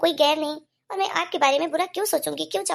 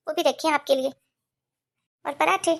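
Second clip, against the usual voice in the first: ordinary delivery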